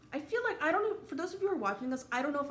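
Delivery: fighting the tears as it rises; holding back tears. Real, not acted. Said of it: "I'm trying so hard not to cry".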